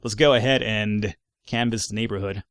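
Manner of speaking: ordinary talk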